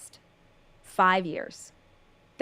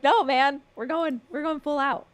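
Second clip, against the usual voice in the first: higher, pumped-up quote; mock bravado; 5 semitones higher than usual. Quoting herself to cohost, lightly pumped up. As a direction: hype yourself up, higher. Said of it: "I was like".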